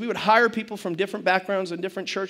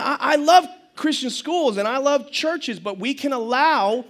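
ordinary talk, nothing marked

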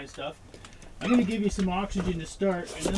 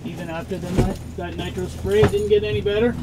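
urgent calling; 3 semitones higher, 5 dB louder than usual. Acted. Patient deteriorating, paramedic calls.

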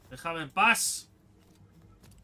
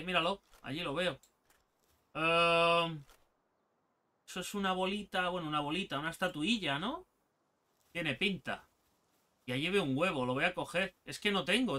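normal talking voice

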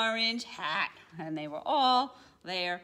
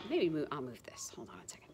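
whispering; 6 semitones lower, 6 dB quieter, much faster than usual. Real, not acted. Aside while adjusting things.

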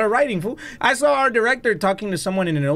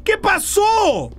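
real scream; 14 semitones higher, slower than usual. Real, not acted. Horror gamer screaming at a jump scare.

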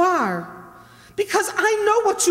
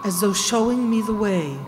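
lower, measured storytelling; suspense narration; 6 semitones lower than usual. A narrator's reading. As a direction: tell the tale to build dread. Narrates eerie cemetery walk.

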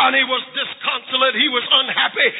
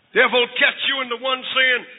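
usual speaking voice

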